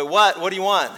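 mild mock yell from a tired dad; a lightly voiced father calling back down the hall. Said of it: "The father yells down the hallway".

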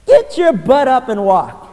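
slow, loud command; a touch of a blunt, loud command; commanding order. Retold or imitated. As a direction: order him to his feet. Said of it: "He said".

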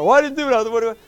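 high, loud mock outrage; irate driver. Driver protesting to the deputy, high and loud in mock outrage. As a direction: sputter like a pulled-over driver. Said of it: "the people were irate".

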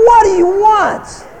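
high, loud, slow challenge; loud incredulous jab; scornful demand. Real, not acted. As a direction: throw the question back hard. Mocking men wanting praise.

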